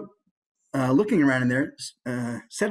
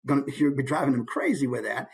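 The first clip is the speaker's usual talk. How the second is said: usual speaking voice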